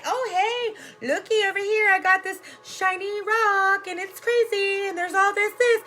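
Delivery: high, hammed-up mock huckster voice; mocking distractor. Mocking newscasters as distracting hucksters, high mock voice. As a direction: wave a shiny rock at the crowd in a high mock voice. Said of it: "it's like somebody saying".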